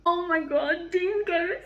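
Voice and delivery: ordinary talk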